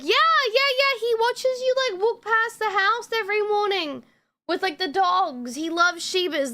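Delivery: high, bubbly mock neighbor, easing down gradually; gushing neighbor imitation. Neighbor explains her son watches the dog walks, imitated gushing.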